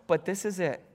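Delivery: quiet mock heartbreak; mock sadness. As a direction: let it sink quietly, playing up the loss. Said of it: "you get sad".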